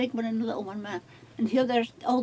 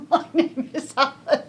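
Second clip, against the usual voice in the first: laughing; 3 semitones higher, 4 dB louder, slower than usual. Retold or imitated.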